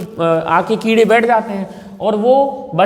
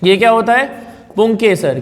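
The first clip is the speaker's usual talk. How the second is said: normal talking voice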